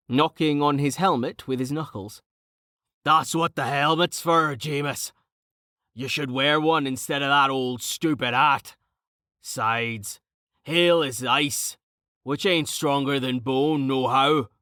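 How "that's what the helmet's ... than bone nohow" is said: hearty, teasing character voice with folksy diction; boisterous, rough-spoken Carten. Acted. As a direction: play a cheerful brute ribbing his friend.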